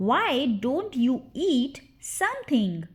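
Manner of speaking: slow, word-by-word teaching take; deliberate, drawn-out drill model; slow repeat. Shown as a demonstration. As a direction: separate the words for learners. Drill, slower repeat for learners.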